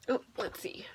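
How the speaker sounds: quick whispered aside. Real, not acted. Parent whispering while finding the page. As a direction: whisper it to yourself.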